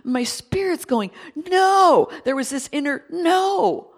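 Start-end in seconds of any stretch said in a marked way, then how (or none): 1.3-2.1 loud, high, drawn-out No; forceful cry of No; inner cry. Retold or imitated. Spirit crying out no. 3.1-3.9 loud, high, drawn-out No; forceful cry of No; inner cry. Retold or imitated. Spirit crying out no.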